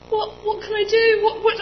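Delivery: high, tearful panic, near crying; the voice cracks with panic; panicking. Retold or imitated. Asking what to do, high tearful panic. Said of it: "And I was panicking".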